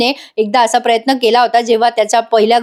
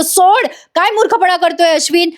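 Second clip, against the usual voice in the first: higher-pitched, mild mock reproach; acted scolding; 7 semitones higher than usual. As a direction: imitate a brother's urgent telling-off. Acted alarmed scolding of brother. (नाटकी सुरात हलकेच रागावून)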